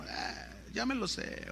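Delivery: a whispered, bored shrug; mock dismissive. Preacher mimics listeners skimming verses, whispered mock boredom. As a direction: toss it off as if you know it all.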